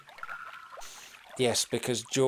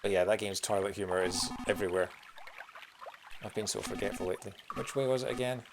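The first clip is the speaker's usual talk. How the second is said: ordinary delivery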